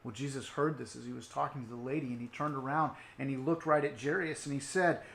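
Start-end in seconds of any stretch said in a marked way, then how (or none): none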